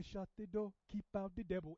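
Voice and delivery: lightly imitated African accent. Imitating African brothers at prayer, light African accent. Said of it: "say it like that with a good solid accent".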